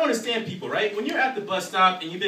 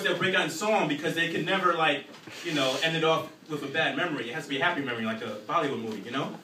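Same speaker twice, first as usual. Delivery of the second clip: usual speaking voice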